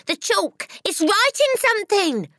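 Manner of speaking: high, loud schoolboy shriek; excited scream. A narrator's reading. Nigel seeing the chalk write, screaming. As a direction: scream the news across the room. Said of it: "screamed Nigel".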